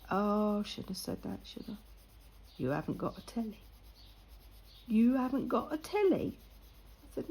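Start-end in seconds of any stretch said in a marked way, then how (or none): 2.6-3.6 low, quick, muted echo of disbelief; quiet disbelief. Retold or imitated. Retelling the lad's disbelief. 4.9-6.4 imitated disbelief, pitched up into a question; incredulous repeat. Retelling the lad's louder disbelief.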